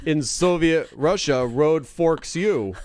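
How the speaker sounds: slow mock Russian accent; hammed-up Russian accent, slow. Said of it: "Like that?".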